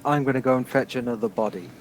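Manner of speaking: usual speaking voice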